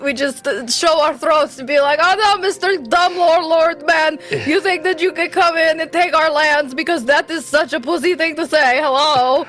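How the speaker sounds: put-on Slavic accent. Player challenges the villagers, indignant in mock Slavic accent. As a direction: scold them in a hammed-up Slavic accent. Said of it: "look at, like, these people and be like".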